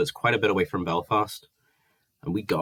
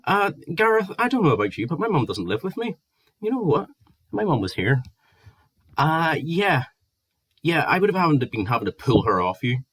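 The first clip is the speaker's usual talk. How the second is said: heated retort; 8 semitones higher than usual. Retold or imitated. Retold heated row with Gareth.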